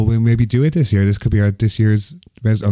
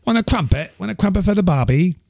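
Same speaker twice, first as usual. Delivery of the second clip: high-pitched mock English turning Australian; muddled English-Australian accent; 9 semitones higher than usual. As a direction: put on English, slip into Australian. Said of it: "I'm getting confused in my accents now".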